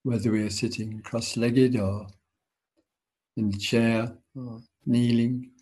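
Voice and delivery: guided meditation. Acted. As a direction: ease them into stillness, unhurried.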